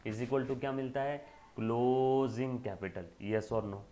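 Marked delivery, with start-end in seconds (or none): 1.6-3.1 "closing" stretched very long; slow, sustained prompt to the class; drawn-out answer. Real, not acted. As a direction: hold the first vowel of "closing", then finish "capital". Teacher repeating the stretched answer.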